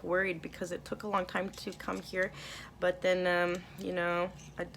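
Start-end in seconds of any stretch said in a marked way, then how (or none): none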